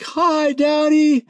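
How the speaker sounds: put-on very high, sweet little-kid voice; kids' voice. Father imitating his small kids greeting him. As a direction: chirp it like a tiny child. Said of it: "my three little kids pop out and they're like".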